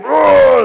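loud, high drawn-out roar; mock monster roar. Storyteller imitating the bad team, mock roar. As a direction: roar like a big bad brute.

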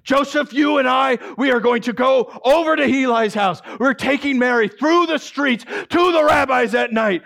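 loud, high commanding shouts; furious father. Acted. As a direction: order the disgrace, no arguing. Furious father gives orders.

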